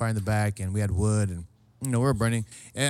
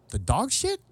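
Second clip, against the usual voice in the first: mock incredulous question; 10 semitones higher, slower than usual. Host realising what was in the bag, mock incredulous. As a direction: ask it in dawning horror.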